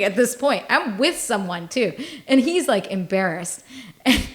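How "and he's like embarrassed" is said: usual speaking voice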